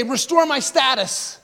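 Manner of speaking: whiny pleading for status; hammed-up grasping prayer; mock selfish plea. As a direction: beg like a spoiled king. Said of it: "David doesn't say".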